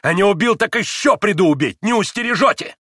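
loud, high, defiant shout; heated cry climbing in pitch; furious threat. A narrator's reading. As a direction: hurl a threat while worked up. Dmitry threatens in a rage.

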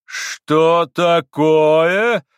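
very slow, loud, high, stretched question; pompous astonished demand; affronted amazement. A narrator's reading. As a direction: draw out each word in indignant surprise. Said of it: "удивлённо уставившись на Колю".

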